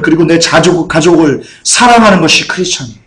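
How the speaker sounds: ordinary talk, nothing marked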